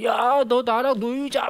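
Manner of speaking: put-on a kid begging a friend to play; clingy child. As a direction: drag it out like a needy kid.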